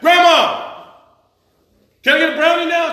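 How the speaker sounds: put-on high, loud pleading kid; loud kid begging. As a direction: call out to grandma like an eager child. Kid begging grandma for brownie.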